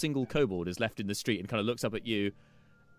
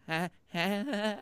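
nervous kobold; 11 semitones higher than usual. Acted.

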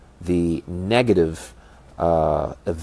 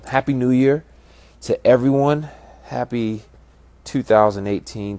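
excited greeting; 5 semitones higher, 4 dB louder than usual. Real, not acted. New Year greeting to listeners.

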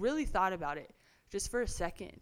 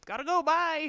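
higher and louder, hurried exit; quick brush-off; 10 semitones higher, 9 dB louder than usual. Retold or imitated. Cousin dodging shady people, high hurried brush-off. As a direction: bolt from the shady company. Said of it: "she'd be like".

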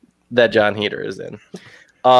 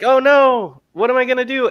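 mock panic; 11 semitones higher, 4 dB louder than usual. Imitating a panicked Jimmy Fallon.

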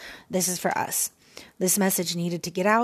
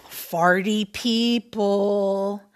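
slow, louder silly drawl; goofy drawn-out tease; 5 dB louder, much slower than usual. Real, not acted. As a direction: stretch it out for a laugh. Playful name-calling, dragged out.

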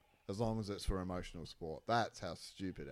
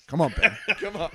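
higher, louder laughing urging; mock cheering; 12 semitones higher, 12 dB louder than usual. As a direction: push him to the finish.

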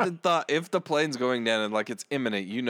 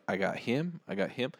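quiet mutter; 4 semitones lower, 5 dB quieter than usual. Retold or imitated. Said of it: "I'm like".

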